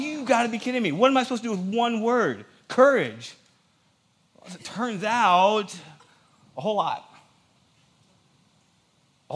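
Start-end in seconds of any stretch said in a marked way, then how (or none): 0.0-3.4 mad, high-pitched protest, half in jest; retold indignation. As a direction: complain in exasperated disbelief, voice pitched up. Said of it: "I was so mad".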